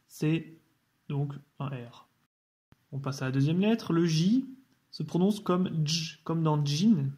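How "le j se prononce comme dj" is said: normal talking voice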